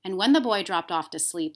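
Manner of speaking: ordinary delivery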